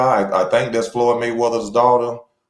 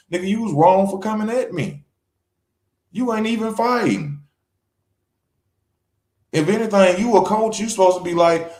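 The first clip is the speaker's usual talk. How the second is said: higher-pitched voice scolding the coach; mock Shakur retort; 10 semitones higher than usual.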